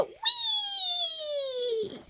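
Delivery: long high falsetto squeal; crying toy dragon. Pretend. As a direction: pretend wail, thin and drawn out. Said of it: "Dragon go".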